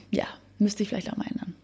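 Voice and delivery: quick embarrassed whisper; hurried whispered aside. Real, not acted. Sheepish aside about dentist.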